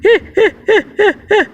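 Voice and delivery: a burst of high, real laughter. Real, not acted. Alien vlogger laughing at his old critics, high real laugh. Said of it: "I am laughing here".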